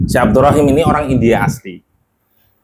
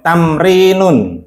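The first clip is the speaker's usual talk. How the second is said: "tamrinun" drawn out slowly, higher; model for repeating; 4 semitones higher, much slower than usual. Shown as a demonstration. Teacher models a word for students to repeat, slow model reading. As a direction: stretch out each syllable of "tamrinun".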